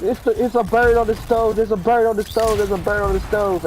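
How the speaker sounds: high, loud panicked repeating; squealing it over and over in alarm; sister voice. Retold or imitated. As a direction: sister hammed-up screaming. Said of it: "She was like".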